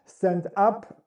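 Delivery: ordinary delivery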